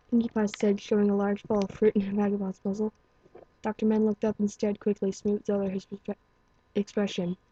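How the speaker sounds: ordinary delivery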